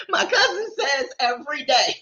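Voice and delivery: words squeezed out high through laughter; laughing. Real, not acted. Preacher telling viewers about her cousin, laughing through it. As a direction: talk while still cracking up.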